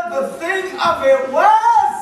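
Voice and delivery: ordinary talk, nothing marked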